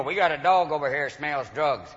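lightly voiced cop, low and soft; low, quiet word from an officer; Southern cop. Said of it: "They usually come up to you and go".

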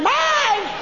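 put-on very high-pitched toddler screech; mock a small child shrieking over a toy; piercingly high, drawn-out possessive yell; yelling three-year-old. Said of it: "this child pulling on something and yelling".

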